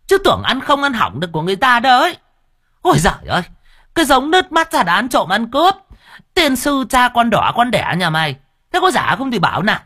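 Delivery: high, loud, fast market-woman tirade; shrill scolding. Acted. Market woman cursing the young thief, high and furious. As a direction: rip into him, voice high.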